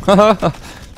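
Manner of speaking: short amused laugh; a quick nervous chuckle. Real, not acted. Laughs while hiding in cover.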